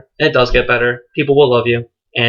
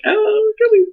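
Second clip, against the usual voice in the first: much higher squeaky "really?" in fake shock; mock dismay. Son balking at telling dad, high mock dismay. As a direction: squeal it like you just got caught out. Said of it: "I was just like".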